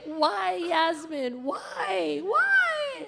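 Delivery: high, rising mock wail; wailing little girl. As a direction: cry out like a hurt kindergartner. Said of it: "she was like".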